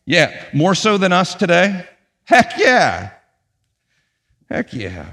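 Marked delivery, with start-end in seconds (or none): 2.2-3.1 loud, high heck yeah; emphatic exclaim. Acted. Preacher answering own question emphatically. 4.5-5.1 soft, low heck yeah; quiet echo. Acted. Same words repeated as aside.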